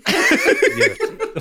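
slow, laughing disbelief; cracking up in disbelief. Real, not acted. Laughing incredulous question.

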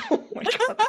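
high squeal of laughter; very high laughing oh my god. Real, not acted. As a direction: crack up at the pirate voice. Laughing at pirate accent.

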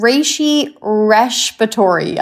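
"respiratory" lisped as RESH-piratory, drawn out slowly; slight lisp. Shown as a demonstration. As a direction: slowly link "reishi respiratory" with a SH sound. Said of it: "do a little lisp with it".

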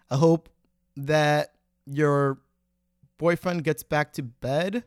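normal talking voice

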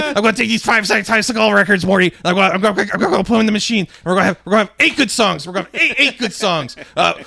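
loud, high cartoon scientist, stammering; rushed, stuttering mad-inventor shouting; Rick. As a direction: rant a crazy plan at your sidekick. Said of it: "Rick and Morty guy fucking bit".

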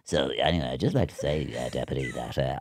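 low, soft muttered political speech; absent-minded rehearsal. Retold or imitated. Practising a politician while making a sandwich, low muttering. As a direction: murmur the speech to yourself.

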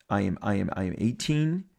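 usual speaking voice

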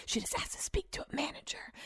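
soft unvoiced aside; whisper. Wife whispering to husband at the bar, excited whisper. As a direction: whisper it so she can't hear.

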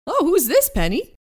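high eager boat voice; chatty, earnest friendly boat; goofy Herman voice. Acted. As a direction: Herman urging them to camp, high and goofy. Herman voice again.

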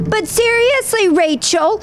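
put-on high, whiny little girl voice; pleading like a small child; child's voice. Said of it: "And she's like".

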